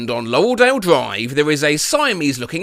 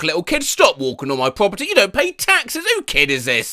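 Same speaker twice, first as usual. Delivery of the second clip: normal talking voice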